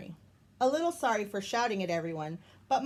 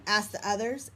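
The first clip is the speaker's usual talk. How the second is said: plain reading voice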